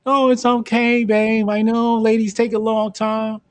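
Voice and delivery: high, sugary pushover voice; mock nice guy. Mocking a too-nice guy.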